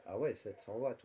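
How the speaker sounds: ordinary talk, nothing marked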